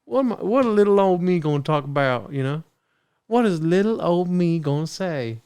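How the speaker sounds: a put-on Southern lady voice, fretting; Southern woman impression.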